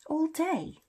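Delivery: high-pitched astonished echo; incredulous. Acted. Dumbledore questions his colleague's day on the wall, incredulous. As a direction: throw it back in surprise. Incredulous repeat of McGonagall's words.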